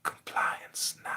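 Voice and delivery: hushed, drawn-out command; mock judge's order. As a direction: end in a slow mock whisper. Mimics a judge giving orders.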